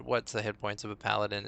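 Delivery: normal talking voice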